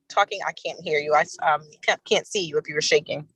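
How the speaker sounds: ordinary talk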